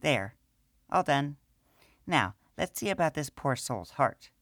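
high small gnome woman's voice, matter-of-fact; gnome priestess. Acted. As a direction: speak as the little priestess.

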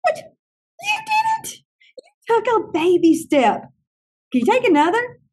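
high-pitched, amazed cheering; acted-out gushing encouragement; delighted praise. Retold delighted praise of child.

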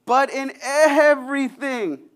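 slow, emphatic lift on "everything"; stress on everything. Real, not acted. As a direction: lean hard on "everything", stretching it out. Preacher contrasts nothing with everything.

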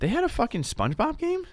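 high, incredulous question; can't believe it, pitch jumps; surprised. Real, not acted. Shocked that a SpongeBob game exists.

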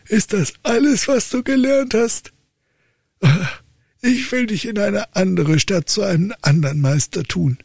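high, exasperated father voice; old count lecturing his dull son; stern old count. Acted. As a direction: play the despairing old father.

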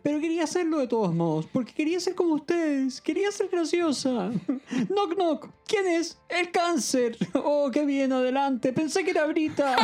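high, hammed-up female voice; emotional confession. A narrator's reading. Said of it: "Brita emocionada se confiesa ante todos".

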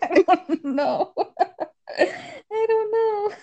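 high, drawn-out words through giggles; helpless laughing. Real, not acted. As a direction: squeal it out while laughing hard. Helpless laughter at own reading.

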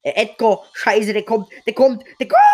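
loud alarmed yelling, laughing; panicking as an enemy rushes in; panicked shout. Real, not acted.

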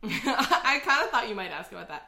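talking through a laugh. Real, not acted. Host amused by co-host's expected question, laughing.

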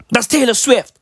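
usual speaking voice

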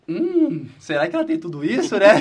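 um hum agudo, em tom maroto; cobiça fingida. Retold or imitated. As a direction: pense alto fingindo cobiça, bem mais agudo. Pensamento interesseiro imitado. (ganância de brincadeira, com um hum bem agudo)

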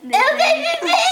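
giggling through the words; trying to sing but laughing; stifled giggles. Real, not acted. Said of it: "porque você tá rindo".

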